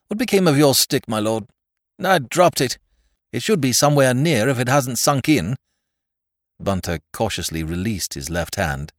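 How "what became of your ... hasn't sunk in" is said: high, tense and urgent; urgent question. Acted. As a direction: ask urgently in danger. Urgent exchange in the bog.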